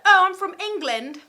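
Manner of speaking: loud, high fake English accent. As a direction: imitate an English fan at full volume. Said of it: "that was an English accent".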